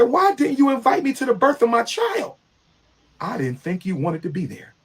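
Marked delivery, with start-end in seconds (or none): 0.0-2.4 outraged question to the ex; indignant. Retold or imitated. Confronting ex over birth.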